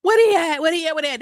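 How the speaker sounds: hammed-up desperate searching; mock begging. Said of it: "begging and crying and snot nose and saying".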